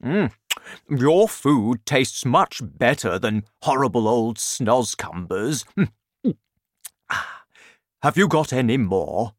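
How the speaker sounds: giant savouring his food, in character; delighted hungry giant. A narrator's reading. BFG praising the Queen's breakfast, happily greedy.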